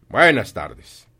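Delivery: very loud, angry goodbye; curt dismissal. Acted. Scrooge ends the talk with his nephew, loud and dismissive. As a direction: show him the door with your voice.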